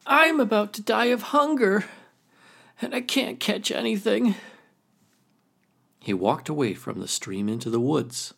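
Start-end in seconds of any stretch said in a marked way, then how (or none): 0.1-4.3 high, whiny raccoon voice; complaining raccoon. Acted. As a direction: whine it like a starving trickster. Raccoon laments aloud, high voice.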